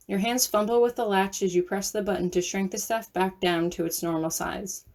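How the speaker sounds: ordinary delivery, nothing marked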